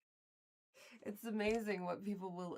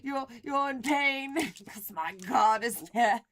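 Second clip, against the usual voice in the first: over-the-top loud zealot voice; mock preacher; 15 dB louder than usual. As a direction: ham up a zealot's gloating. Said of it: "He's like".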